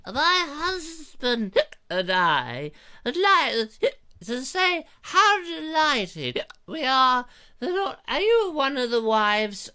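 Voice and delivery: high, regal falsetto lady; posh wife voice. Acted. Host mocks a wife from the play in high regal voice. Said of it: "excerpt there from the Merry Wives".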